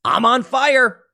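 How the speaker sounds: hammed-up burning bull; raging bull. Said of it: "knowing that this is it".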